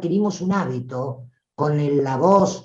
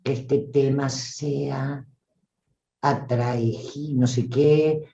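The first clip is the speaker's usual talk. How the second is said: slow, low, drawn-out delivery; plodding mock-solemn drone; slow, halting speaker; 4 semitones lower than usual. Shown as a demonstration. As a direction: leave long pauses and let the energy sag. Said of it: "como te dice la pausada".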